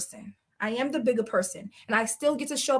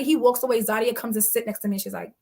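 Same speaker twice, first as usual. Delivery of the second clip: ordinary talk, nothing marked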